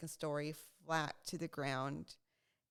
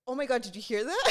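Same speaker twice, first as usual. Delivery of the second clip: excited; 6 semitones higher, 9 dB louder, faster than usual. Retold or imitated. Excitedly retelling hearing the hamster.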